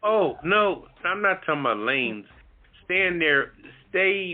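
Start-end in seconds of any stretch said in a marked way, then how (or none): none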